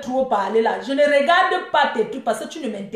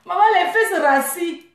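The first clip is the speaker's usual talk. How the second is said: laughing mockery; 5 semitones higher than usual. Real, not acted. Mocking the rival, laughing.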